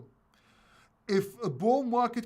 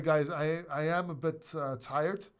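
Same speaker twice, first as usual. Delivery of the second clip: usual speaking voice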